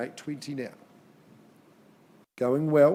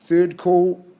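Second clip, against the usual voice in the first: loud call; 4 semitones higher, 11 dB louder than usual. Real, not acted. Auctioneer calling final call.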